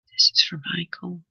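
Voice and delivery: hushed whisper to the spirit guides; whispered aside naming the caller. Real, not acted. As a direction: breathe it out as a private whisper.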